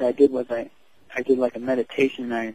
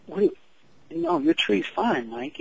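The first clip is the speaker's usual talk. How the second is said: mom's voice pitched higher, then slowing to soothe; mother reassuring; 7 semitones higher, slower than usual. Retold or imitated. Mom calms her son over his painting, higher then slow and soothing.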